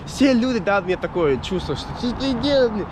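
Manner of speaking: ordinary talk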